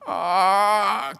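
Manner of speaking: high, loud gasp of pain. A narrator's reading. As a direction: gasp as if struck. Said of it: "gasped the dryad".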